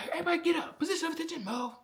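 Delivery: very high, strained barked orders; drill sergeant. Retold or imitated. Drill sergeant catching talkers in reception, mock yelled orders. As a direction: imitate a sergeant yelling commands. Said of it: "drill sergeant would talk".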